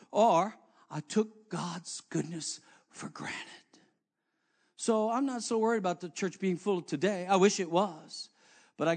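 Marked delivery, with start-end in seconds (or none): 0.9-3.4 slow regretful whisper. Retold or imitated. Preacher voicing a repentant sinner in a whisper. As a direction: breathe it out in regret.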